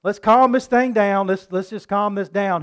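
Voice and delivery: loud, slowed call to settle the crowd; a leader waving the mob down, loud; calming crowd. Retold or imitated. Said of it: "And further people said".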